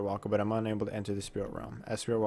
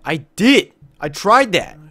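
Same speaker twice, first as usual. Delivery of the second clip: loud, higher protest; frustrated shout; 9 semitones higher, 16 dB louder, slower than usual. Real, not acted. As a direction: yell that you already did it. Frustrated at repeated instructions.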